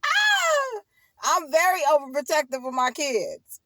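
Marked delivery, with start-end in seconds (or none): 0.0-0.8 a sudden high loud wail; loud wailing cry. Real, not acted. Mother crying out about her overprotectiveness, with a high loud wail.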